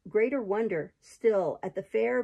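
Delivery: usual speaking voice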